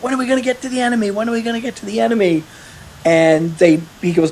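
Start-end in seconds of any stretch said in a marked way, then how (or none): 0.0-2.4 higher, fast and impatient, mimicking the kid; eager young soldier. Retelling a young recruit pestering about combat, eager and fast. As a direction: rattle it off like a restless rookie. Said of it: "He met a young guy who was like".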